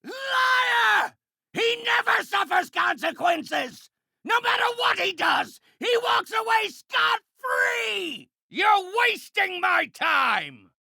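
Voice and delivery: very high, screaming villain rant; enraged shouting. Acted. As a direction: rage at the injustice at full pitch. Villain rages in fight.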